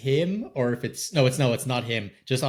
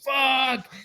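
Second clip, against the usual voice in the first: mock pilot curse; 13 semitones higher than usual. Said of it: "that he would go".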